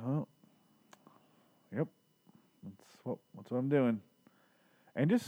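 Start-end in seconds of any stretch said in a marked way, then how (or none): 0.1-4.0 small, halting, underplayed resignation; mock-casual dying man; nonchalant. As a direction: shrug it off in little pieces, with long pauses. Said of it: "in such a nonchalant way".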